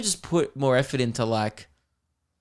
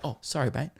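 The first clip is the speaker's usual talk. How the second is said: snapping back to polite; 8 dB quieter than usual. Retold or imitated. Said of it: "the guy just snapped out".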